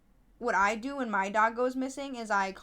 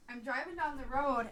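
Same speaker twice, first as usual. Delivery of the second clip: usual speaking voice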